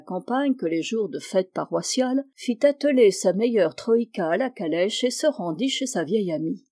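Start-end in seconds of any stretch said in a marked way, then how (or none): none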